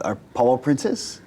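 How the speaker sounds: ordinary delivery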